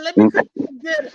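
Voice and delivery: fast, high, sugary offer of dinner; a mad woman sweetly offering to cook dinner. Retold or imitated. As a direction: chirp it like a too-sweet wife. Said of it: "and she said".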